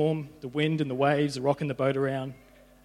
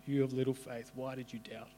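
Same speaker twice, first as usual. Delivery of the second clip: softer, hushed and tender second take; soft tender echo; 6 dB quieter than usual. Acted. Jesus to Peter, softly repeated tender rebuke. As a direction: let it fall away to almost nothing.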